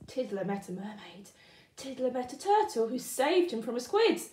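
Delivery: plain reading voice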